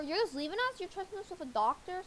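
usual speaking voice

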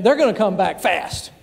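ordinary talk, nothing marked

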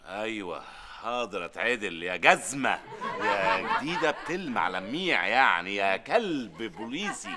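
slow, low mock-sweet insults; grudgingly obey while sneaking in insults; sarcastic compliance. [name] pretending to apologize to Ramzi, slow sarcastic drawl.

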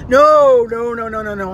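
loud first NO, then tumbling down; emphatic refusal. Retold or imitated. Dad turns down leftover pudding, emphatic, tapering refusal. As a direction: wave it off with falling, repeated noes.

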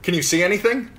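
loud, high anxious call. Acted. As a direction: call up, suddenly wary. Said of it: "Sir Waymar called up".

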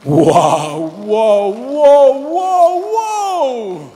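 loud, astonished wows climbing high; Jesus bursts out in loud amazement; amazed wow. Acted. Jesus marveling at the centurion's faith, loud amazed cry. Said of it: "Y Jesús dijo".

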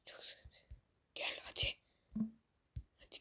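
unvoiced tense whisper. Real, not acted. Gamer whispers to himself while sneaking in the match. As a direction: whisper like you're hiding in the bushes.

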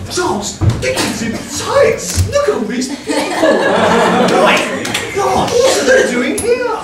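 shrill camp pantomime dame. Acted. Dame shrieks at the crowd.

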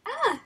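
short, high, loud "ah"; sudden yelp of nerves; frightened yelp. Real, not acted. Player alone at a horror game, frightened yelp. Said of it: "I am terrified".

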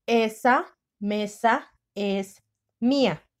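very slow, word by word; slow dictation voice. Shown as a demonstration. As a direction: dictate slowly for the class.

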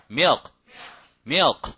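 "milk" with a dark L and a barely released k; careful milk. Shown as a demonstration. Teacher shows how to say milk properly. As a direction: drop the tongue back after the L in "milk". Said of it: "제대로 해요".

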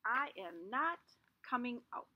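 ordinary delivery

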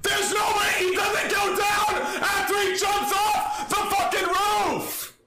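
real furious disbelieving yell; raging gamer. Real, not acted. Disbelief after enemy snipes.

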